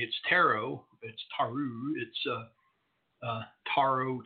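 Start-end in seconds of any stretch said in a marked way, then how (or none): none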